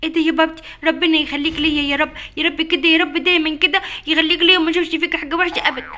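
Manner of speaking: shower dad with happy thanks; excited thanks. Acted. Child thanks father for gifts.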